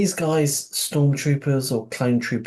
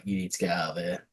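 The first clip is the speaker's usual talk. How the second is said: hushed warning; 4 semitones lower, 8 dB quieter than usual. Retold or imitated. Quoting Nala Se.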